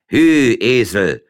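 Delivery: loud sharp shout at the donkey. A narrator's reading. Farmer cutting off his wife by shouting at the donkey. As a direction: yell to drive the donkey on. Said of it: "schrie der Mann dazwischen".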